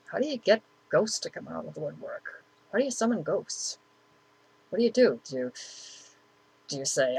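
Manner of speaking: hushed, half to himself; quiet. Retold or imitated. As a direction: ask it softly to the empty room.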